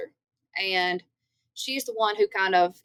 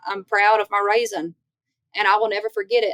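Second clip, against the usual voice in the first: ordinary talk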